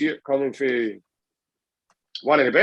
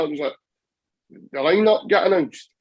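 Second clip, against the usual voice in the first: ordinary talk, nothing marked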